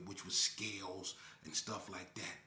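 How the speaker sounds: ordinary delivery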